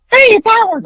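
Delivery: imitating a friend's squeaky voice; squeaky Howard voice. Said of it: "that, like, squeaky ... voice".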